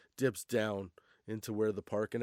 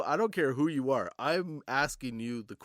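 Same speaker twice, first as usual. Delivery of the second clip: usual speaking voice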